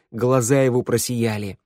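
plain reading voice

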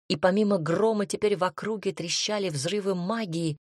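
ordinary delivery

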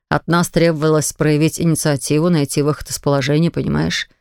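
a hint of a father reasoning gently; lightly voiced patient father. A narrator's reading. Father explaining to his son on his knees, low and patient.

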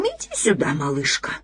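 dry, rustling creepy voice; creaky voice. Acted. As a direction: rasp it like a dead insect. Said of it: "раздавшимся вновь скрипучим голосом".

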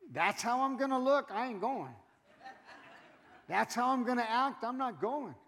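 mock the coworker refusing to come; a touch of a scornful coworker's protest. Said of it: "your coworker goes".

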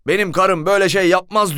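high, loud outraged denial; indignant shout. Retold or imitated. Husband denying it to the police, outraged loud shout. Husband defends wife's honor.

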